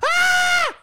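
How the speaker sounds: high, loud horrified shriek; panicked scream. Retold or imitated. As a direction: shriek at the sight of cocaine. Parent finds child snorting cocaine.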